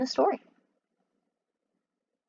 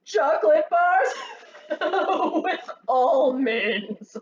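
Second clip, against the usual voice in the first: higher and louder, broken by laughter; laughing; 7 semitones higher, 3 dB louder, slower than usual. Acted. Mac laughs as he lists the candy. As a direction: list it while cracking up.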